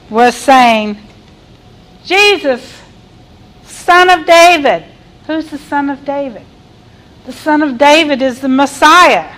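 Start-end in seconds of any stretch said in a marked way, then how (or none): 2.0-4.8 raised, earnest call, louder on the title; cry of faith. Acted. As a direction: call out with conviction. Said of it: "had a little different tone".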